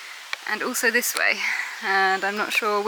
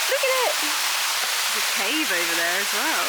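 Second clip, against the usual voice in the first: excited. Real, not acted. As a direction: gush with delight. Showing the pool.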